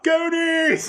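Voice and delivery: high mock shout from the audience; drawn-out, high-pitched retold holler; imitated heckler yell. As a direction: yell it out like a fan heckling an actor. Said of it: "someone in the audience will yell out".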